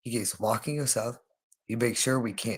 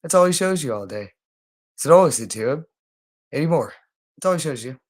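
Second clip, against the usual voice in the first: raised plea; 3 semitones higher, 5 dB louder, faster than usual. Real, not acted. Pleading with Brittany, voice rising.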